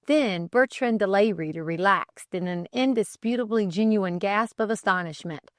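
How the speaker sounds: ordinary delivery, nothing marked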